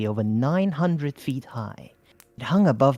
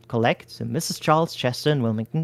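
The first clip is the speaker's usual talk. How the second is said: usual speaking voice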